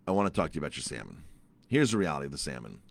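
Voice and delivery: low, quick retold insistence; low, no-nonsense restatement; firm insistence. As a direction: drop the pitch and press the point. Insists on his request again.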